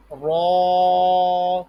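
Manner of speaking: را full and rounded with puffed mouth, held long; heavy ra. Shown as a demonstration. Teacher models the letter for pupils, heavy long را. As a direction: sustain a loud, heavy را. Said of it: "منہ پھلا دیں".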